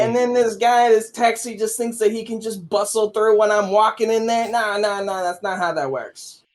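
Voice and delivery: loud, high New York street rant; loud New York tough-guy tirade; New York accent. Retold or imitated. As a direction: rant like a New Yorker dodging a taxi. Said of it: "your New Yorkin accent".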